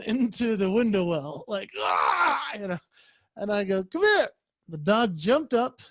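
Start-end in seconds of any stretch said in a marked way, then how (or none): 1.8-2.4 effort grunt. Retold or imitated. Straining down into window well. 3.8-4.3 much higher sing-song coax; baby-talk come here to a pup; coaxing a dog. Retold or imitated.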